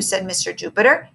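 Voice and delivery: ordinary delivery, nothing marked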